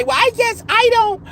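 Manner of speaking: high mocking imitation of a woman in the thread; mocking her. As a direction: parody her as clueless and whiny. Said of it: "now she wanted to say".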